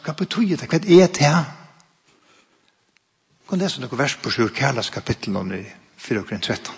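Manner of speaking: ordinary talk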